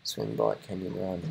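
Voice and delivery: hushed repeat of the slogan; whispered. Shown as a demonstration. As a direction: whisper the list to yourself. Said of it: "repeats the phrase in whisper".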